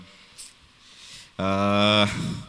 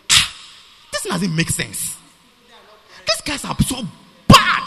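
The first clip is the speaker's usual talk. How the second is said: surprised angels; 4 semitones higher than usual. Retold or imitated. Angels shocked at lowly humans, voiced surprised. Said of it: "they are surprised".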